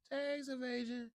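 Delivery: dramatic mock. Real, not acted. Mocking the tax threat.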